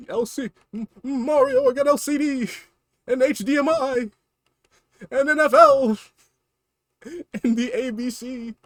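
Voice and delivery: high, pleading little-brother voice with laughs; whiny Luigi. Acted. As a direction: squeal for Mario's help. Luigi voice listing.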